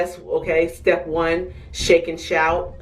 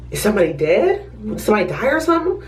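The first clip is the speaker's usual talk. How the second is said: higher, fast prying question; nosy paramedics; 4 semitones higher, much faster than usual. Retold or imitated. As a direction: rush it out like an eager gossip. Paramedics asking who died.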